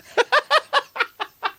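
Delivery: high, staccato burst of laughter; real laughing fit. Real, not acted. Co-host cracks up after being told off over sarcasm, high laughing fit.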